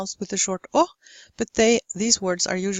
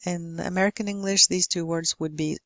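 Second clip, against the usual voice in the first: ordinary delivery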